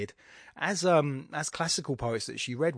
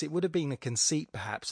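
ordinary talk

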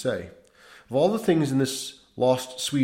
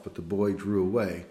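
ordinary delivery, nothing marked

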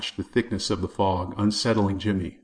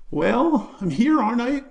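put-on a scared boy trying to sound brave, voice breaking; high, broken voice; 5 dB louder than usual. A narrator's reading. Jimmy answering the bully as his voice cracks high. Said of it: "how high-pitched and broken his voice came out".

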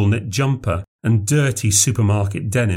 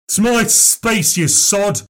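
much higher, slow, spat-out insult; angry old man's yell; 10 semitones higher, slower than usual. A narrator's reading. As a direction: snarl it at the outsider. Old man insults Christopher.

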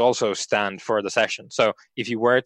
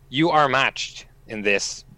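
normal talking voice

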